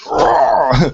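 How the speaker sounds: loud, high growled "rah"; playful roar. Pretend. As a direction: imitate a burst of energy as a roar. Imitates burst of matter condensing.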